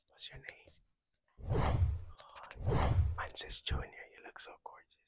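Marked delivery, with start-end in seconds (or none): 0.1-1.8 whispering. Acted. Whispered intro at start. 4.1-5.0 whispering. Acted. Whispered lines at start.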